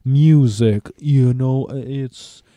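very drawn-out, halting drummer impression. Famous drummer defending music from stealing, slow drawling impression. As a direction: stretch the words in a mock Danish rock-star drawl. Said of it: "the drummer of Metallica".

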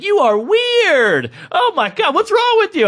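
high outraged woman, first words stretched out; mock flipping out. Woman telling off the prankster, imitated in high outrage. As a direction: rise to a shrieky mock tirade. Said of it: "she, like, flipped out".

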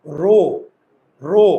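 রো, with the vowel rounded to o; teacher demonstrating রো; pronounced ro. Shown as a demonstration. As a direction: say রো, not র, jaw barely open. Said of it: "র না রো?".

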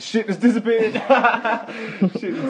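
high, loud, laughing it out. Real, not acted. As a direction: crack up mid-line. Laughing at vanished stream.

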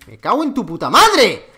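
angry curse. Real, not acted. Raging at opponent.

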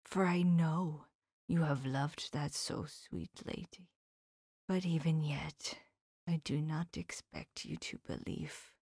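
soft, hushed, gentle whisper; tender. Acted. Professor gently whispering to his grieving friend. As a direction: break the news gently. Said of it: "the tenderness of his face".

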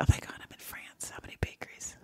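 breathless whisper to herself; whispered thought. Retold or imitated. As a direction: whisper it like a private panic. Panicked inner thought, alone abroad.

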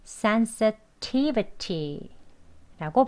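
"sensitivity" slow, SEN and TI both stressed; two stresses. Shown as a demonstration. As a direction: lean on SEN, then hit TI in "sensitivity". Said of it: "sen도 조금 강세를 주어서".

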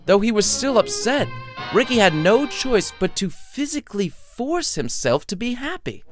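ordinary delivery, nothing marked

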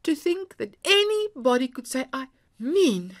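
loud, high indignant outburst; stung and indignant. A narrator's reading.

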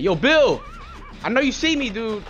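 real loud call. Real, not acted. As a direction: call across to a teammate. Calling teammate across map.

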